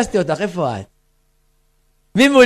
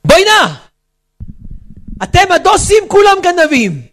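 higher, mock yell at religious women; retold shout from car; 7 semitones higher than usual. Man in a BMW yelling at passing women, mock shout. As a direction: call out the insult across the street. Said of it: "נותן צעקה".

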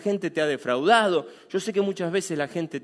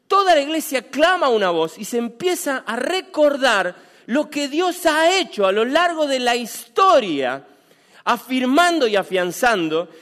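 fervent preaching; 6 semitones higher, 6 dB louder than usual. Acted. Preaching at full force.

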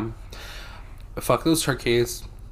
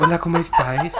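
mock Spaniard accent; 4 semitones higher than usual. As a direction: ham up a Spain Spanish accent, then snap out of it laughing. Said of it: "Why are you talking like that?".

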